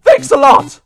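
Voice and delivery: high-pitched loud complaint; exasperated outburst. Real, not acted. Player blames crow for losing, exasperated outburst. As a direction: yell the blame out.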